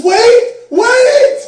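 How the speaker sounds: very high, loud calling out; desperate yell. Retold or imitated. Cab driver calling after the fleeing missionary. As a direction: shout after him to stop.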